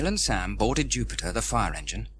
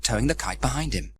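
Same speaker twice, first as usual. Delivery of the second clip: ordinary delivery, nothing marked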